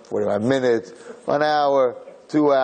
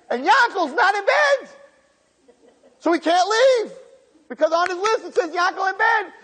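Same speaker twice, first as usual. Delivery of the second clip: building climax. Acted. Preacher driving the story's punchline home to the congregation, high and intense.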